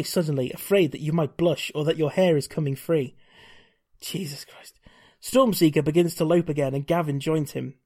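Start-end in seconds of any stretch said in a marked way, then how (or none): 4.0-4.8 breathy unvoiced whisper, much softer; whispered aside. Real, not acted. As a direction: mutter it under your breath in disbelief. Muttered aside while reading romance.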